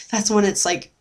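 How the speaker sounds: ordinary talk, nothing marked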